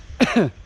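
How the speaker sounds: short dry chesty cough; one tight hacking cough. Real, not acted. Coughing after the run.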